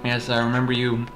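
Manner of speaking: ordinary talk, nothing marked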